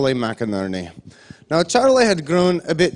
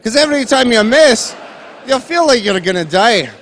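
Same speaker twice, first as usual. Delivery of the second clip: excited inventor; 6 semitones higher, 4 dB louder than usual. Retold or imitated. Charlie pitching his game idea.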